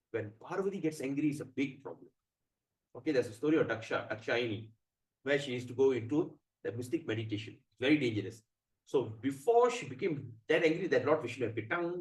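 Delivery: ordinary delivery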